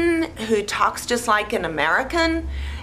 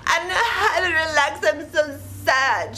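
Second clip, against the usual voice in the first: whiny crying voice; 8 semitones higher than usual. Retold or imitated. Julia's whiny quote.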